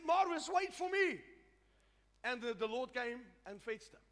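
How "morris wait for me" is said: high, loud retold call; imitated calling out. Preacher voices wife calling her husband in heaven, imitated calling out. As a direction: call after someone, voice lifted high. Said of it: "And she called him".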